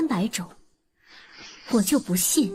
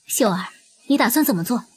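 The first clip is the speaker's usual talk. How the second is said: normal talking voice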